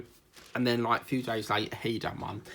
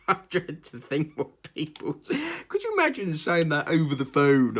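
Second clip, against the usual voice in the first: laughing; 5 semitones higher, 8 dB louder than usual. Real, not acted. Laughing at town name.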